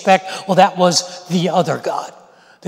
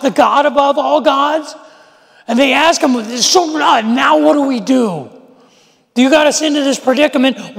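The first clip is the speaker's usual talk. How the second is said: higher, louder frightened questions; panicked pleading; 8 semitones higher, 3 dB louder than usual. Retold or imitated. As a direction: beg him for an answer. Panicked sailors question Jonah.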